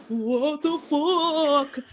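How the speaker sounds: hammed-up stunned yell; acted shock. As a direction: stretch it out in comic disbelief. Daughter snaps out of trance.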